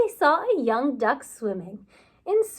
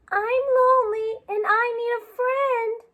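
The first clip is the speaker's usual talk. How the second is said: put-on lonely child voice; 9 semitones higher than usual. Lonely beaver speaks.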